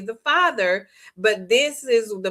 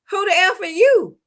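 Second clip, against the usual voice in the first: much higher, louder mock furious demand; angry demand; 10 semitones higher, 6 dB louder than usual. Boyfriend confronting the rival man, mock angry shout. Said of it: "Graham started getting angry".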